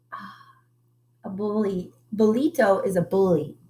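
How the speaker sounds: ordinary delivery, nothing marked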